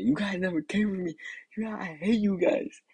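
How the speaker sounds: high, mock-angry whine; retold mad. Crying kid to family who never came, high mock-mad whine. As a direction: protest high, like an aggrieved kid. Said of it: "I was mad as hell too".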